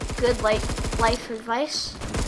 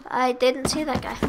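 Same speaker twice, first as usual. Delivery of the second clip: ordinary talk, nothing marked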